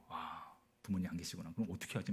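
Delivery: very soft, low murmur; private worried thought. Retold or imitated. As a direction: wonder under your breath, acted. Inner thought on finding parents gone.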